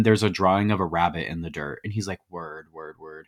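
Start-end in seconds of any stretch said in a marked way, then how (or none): 2.3-3.3 low, much softer repeated reply; muted acknowledgement. Retold or imitated. Retelling him taking in the rabbit drawing, low and muted. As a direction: trail it off quietly, as if to oneself.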